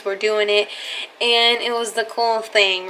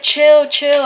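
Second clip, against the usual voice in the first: a higher, louder chill chill; retold loud hushing; 4 semitones higher, 6 dB louder than usual. The driver telling the panicking girl to chill, loud hushing. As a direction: cut her off to calm her.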